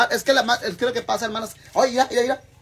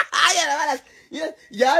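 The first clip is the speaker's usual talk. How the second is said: laughing; 7 semitones higher, 5 dB louder than usual. Real, not acted. Son laughs at father's joke.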